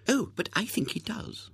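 high-pitched aunt voice; gushing aunt. A narrator's reading. Third aunt disagreeing about William's looks, high and gushing.

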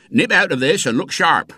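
fierce-voiced boatman barking orders. A narrator's reading. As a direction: order the boy out of your boat. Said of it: "his fierce voice".

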